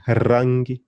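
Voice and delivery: rangi with a trilled r; rangi, strongly rolled opening r. Shown as a demonstration. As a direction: roll the R at the start of rangi. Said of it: "We've got the rolling R".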